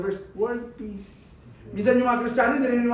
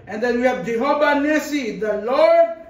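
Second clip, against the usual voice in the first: rising, louder proclamation; preaching build; 4 semitones higher, 6 dB louder than usual. Acted. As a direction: build the congregation to a peak.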